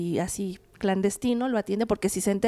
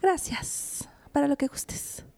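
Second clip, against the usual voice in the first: coy whispered thanks; breathless whisper; 3 semitones higher, 4 dB quieter, much slower than usual. Real, not acted. Coy reply to compliment.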